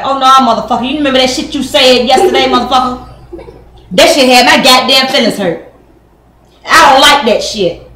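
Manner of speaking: loud, angry mock tirade with laughter; mock yelling. A woman yells at her man about hurt feelings, loud. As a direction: go off on him, loud and cursing.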